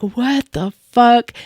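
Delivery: drawn-out, disbelieving; slow incredulous. Retold or imitated. Wife baffled by unbothered husband, slow incredulous. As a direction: stretch it, baffled and annoyed. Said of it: "I was just like".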